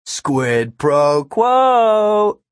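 high, drawn-out teasing delivery; smiling taunt. A narrator's reading. Kid demanding his question before answering, smiling taunt. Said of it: "Todd smiled".

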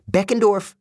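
high-pitched protesting exclamation; demanding. A narrator's reading. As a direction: throw the name at her in protest. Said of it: "I demanded".

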